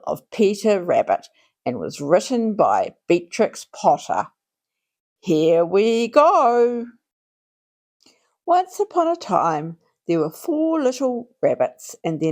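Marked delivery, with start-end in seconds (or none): none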